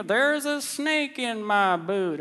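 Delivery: slow, high cowboy drawl; a high, slowed-down talking toy cowboy; Woody impression. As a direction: do Woody's pull-string voice, slow. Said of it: "all that stuff that Andy says".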